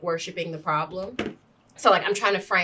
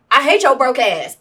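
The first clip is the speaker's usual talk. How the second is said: loud, high imagined landlord's jab; exaggerated hostile eviction voice; mock landlord outburst; 5 semitones higher, 9 dB louder than usual. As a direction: throw it out like a spiteful landlord, loudly.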